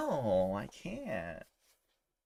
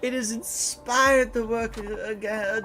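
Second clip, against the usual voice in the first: very high, swooning French diva, loud and slow; questionable French accent; 11 semitones higher, 6 dB louder, slower than usual. Acted. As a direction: gush rapturously in a hammy French accent. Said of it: "do my questionable French accent".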